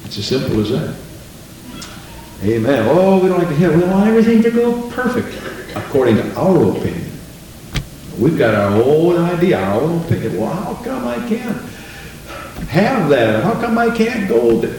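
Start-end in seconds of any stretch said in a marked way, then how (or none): 2.9-5.3 whiny complaint. Retold or imitated. Congregant whining voiced by preacher. 10.4-14.7 whining believer. Retold or imitated. Voiced complaining believer, high pitch.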